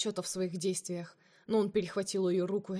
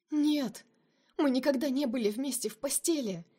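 higher, louder indignant cry; shouting; 6 semitones higher, 4 dB louder than usual. A narrator's reading. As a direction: shout the denial at him. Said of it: "закричала Лина".

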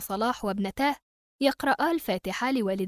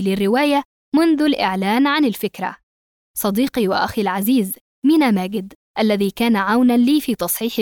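louder formal thanks read; formal acknowledgments; 8 dB louder than usual. Acted. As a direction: read the dedication proudly. Reads acknowledgments.

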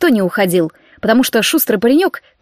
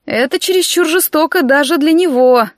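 higher, mildly reproachful voice; disapproving; 6 semitones higher than usual. A narrator's reading. Friend objects to the cruel plan, disapproving. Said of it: "осуждающе покачала головой Ройл".

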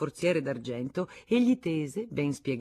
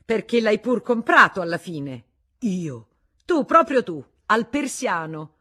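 high, loud accusation, slowing on the last words; accusing; 7 semitones higher, 12 dB louder than usual. Acted. Wife accusing her husband before the guest, heated. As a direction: pin him down, finger pointed.

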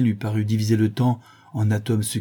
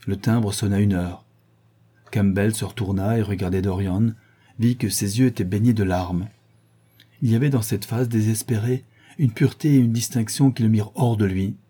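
ordinary delivery

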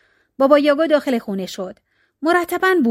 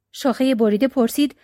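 ordinary delivery, nothing marked

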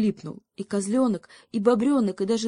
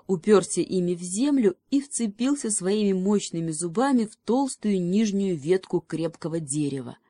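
ordinary delivery, nothing marked

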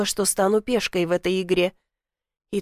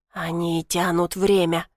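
прошипел; 4 semitones lower, slower than usual. A narrator's reading. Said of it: "прошипел он".

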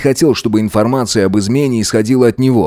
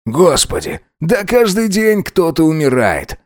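high, drawn-out exasperated complaint; 6 semitones higher, slower than usual. A narrator's reading. Tipsy Alex dismisses his lover's grief over a patient, exasperated. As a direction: complain at a higher pitch, impatient and dismissive. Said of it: "Алекс закатил глаза".

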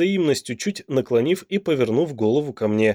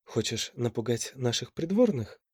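much softer teasing whisper; mysterious whisper; 2 semitones lower, 9 dB quieter than usual. A narrator's reading. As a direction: whisper it slyly. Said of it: "Загадочно прошептала богиня".

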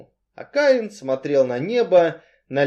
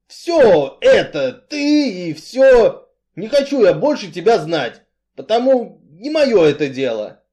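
Artyom rejecting Cain; 7 semitones higher, 9 dB louder than usual. A narrator's reading. Strongman cuts off the man he protected, exasperated. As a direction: burst out with blunt refusal.

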